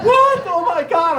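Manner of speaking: sudden high panicked cry; shocked panic. Retold or imitated. Kid told parents aren't coming back.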